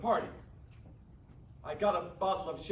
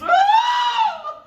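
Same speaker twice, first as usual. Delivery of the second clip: high, loud mock scream; hammed-up woman's scream; 13 dB louder than usual. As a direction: shriek like a startled woman. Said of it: "Myra screams".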